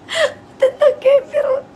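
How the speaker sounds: high, squealing laugh through the words; loud, slow words breaking into laughter. Real, not acted. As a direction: crack up while repeating your own joke. Cracking up at own joke.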